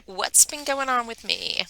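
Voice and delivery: ordinary talk, nothing marked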